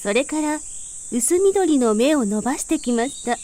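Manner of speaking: ordinary delivery, nothing marked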